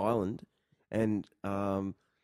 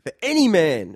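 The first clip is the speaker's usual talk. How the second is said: mock grand announcement; 5 semitones higher, 8 dB louder than usual. Real, not acted. Announcing a big moral question.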